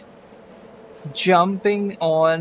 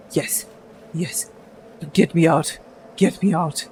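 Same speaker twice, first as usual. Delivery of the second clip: muffled plea. Acted. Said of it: "with a bound mouth".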